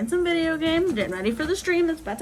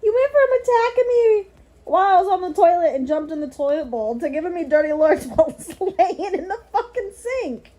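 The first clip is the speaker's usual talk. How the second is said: loud half-laughing telling-off of the cat; a loud, laughing lecture to a misbehaving cat; loud laughing scolding; 3 semitones higher, 10 dB louder than usual. Real, not acted. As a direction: scold her loudly while cracking up.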